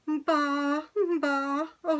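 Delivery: high, far lamb bleat; a high lamb's baa from a shy ewe. Acted. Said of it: "Borreguita bleated".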